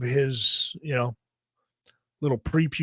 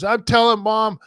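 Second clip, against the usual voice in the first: put-on whiny kid brother; 7 semitones higher, slower than usual. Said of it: "He goes".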